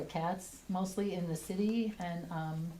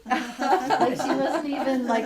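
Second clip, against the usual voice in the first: real laughter through the words, louder; laughing; 5 semitones higher, 8 dB louder, much slower than usual. Real, not acted. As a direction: talk through delighted laughter, drawing it out slowly. Laughs telling the story.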